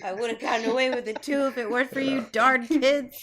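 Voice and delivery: a light put-on cartoon baddie; Scooby-Doo villain. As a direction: play the unmasked villain cursing the meddling kids. Scooby-Doo villain parody quote.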